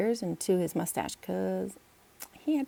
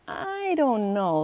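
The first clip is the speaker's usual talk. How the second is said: hesitant; 3 semitones higher, 4 dB louder, much slower than usual. Acted. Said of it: "Logan hesitantly said".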